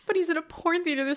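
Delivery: usual speaking voice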